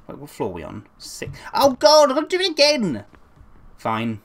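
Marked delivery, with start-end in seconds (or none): 1.5-3.0 loud, high exasperated groan of words. Real, not acted. Gamer faced with redoing a section, loudly exasperated. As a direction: cry out in dismay.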